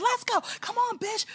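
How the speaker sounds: very high-pitched retold challenge; mock challenge. Retelling her comeback to the attacker, high mock fighting talk. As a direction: re-enact egging on an opponent, pitch shooting up.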